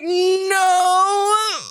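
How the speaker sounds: drawn-out mock wail; retold crying. Woman denying embarrassment to partner, imitated tearful wail. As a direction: wail it as if in tears. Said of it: "'cause I'm crying".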